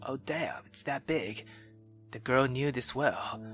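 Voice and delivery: plain reading voice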